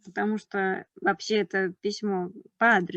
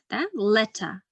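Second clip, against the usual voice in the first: usual speaking voice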